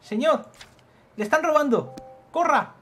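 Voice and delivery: high, loud alarmed calling; urgent warning shout. Acted. Warning a man he is being robbed, urgent shout.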